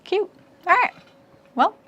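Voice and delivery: talking while chewing gum. Real, not acted. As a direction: say it around a piece of gum. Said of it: "sorry I'm chewing gum".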